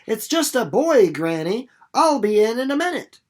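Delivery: put-on loud high girl answering her granny; calling back. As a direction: shout back toward the house. Winnie calls back to Granny.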